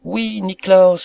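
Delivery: high, loud joyful exclamation; excited cry. A narrator's reading. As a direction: cry out eagerly in confirmation. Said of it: "s'écria le maître d'hôtel".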